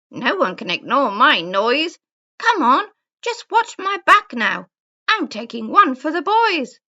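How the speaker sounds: loud, high, swooping bird voice; boastful woodpecker. A narrator's reading. Woodpecker rallies before hammering over the bear, loud boasting.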